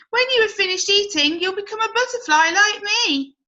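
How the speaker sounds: promising the caterpillar wings; encouraging butterfly. Acted. Cody tells caterpillar.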